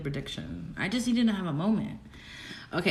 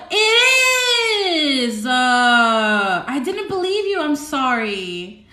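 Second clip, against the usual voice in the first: high, loud, drawn-out disbelief; astonished outcry; 5 semitones higher, 7 dB louder, much slower than usual. Real, not acted. Streamer confirms the moon placement on live, astonished wailing outcry. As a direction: cry out as if you can't believe it.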